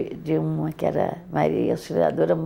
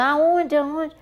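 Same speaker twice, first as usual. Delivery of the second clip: high, alarmed asking; anxious question; 9 semitones higher than usual. Retold or imitated. Mother on the phone desperate for her whereabouts, alarmed and high. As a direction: grab for any news of where she is. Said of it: "Dona Euridice falou".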